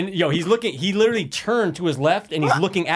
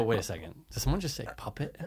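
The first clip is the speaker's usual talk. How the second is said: low, much softer aside to himself; puzzled self-talk; 7 semitones lower, 12 dB quieter than usual. Retold or imitated. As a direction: wonder under your breath whether you heard right. Retells puzzled thought to himself.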